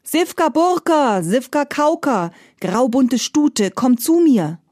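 high, loud, drawn-out summons; hero's call. Acted. Iwan summoning his mare, loud heroic call. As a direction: call the magic mare across the yard like a hero. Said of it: "rief mit Reckenstimme".

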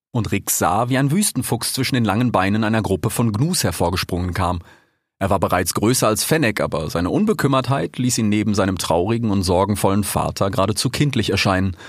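plain reading voice

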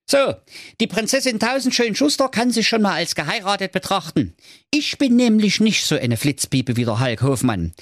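high, boastful show-off prince; cocky prince voice. Acted. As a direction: brag like a vain young prince. (angeberischer Prinz)

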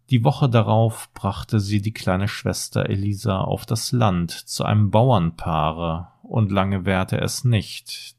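ordinary delivery, nothing marked

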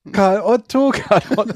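bird call as words. Shown as a demonstration. Imitating the Karl Otto bird for friends. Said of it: "So klingt der, wenn er piept".

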